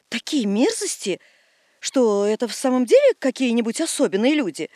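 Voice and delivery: usual speaking voice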